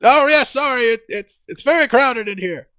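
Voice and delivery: high, loud trivia host; pompous showman quizmaster; Volo's game show voice. Acted. Volo keeps hosting.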